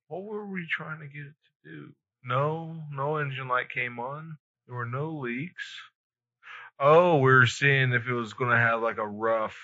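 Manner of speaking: ordinary delivery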